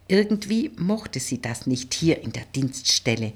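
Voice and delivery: usual speaking voice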